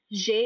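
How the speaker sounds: "J" as zhay, the French name of G; French G, written "J", pronounced zhay; French letter G. Shown as a demonstration. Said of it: "in French".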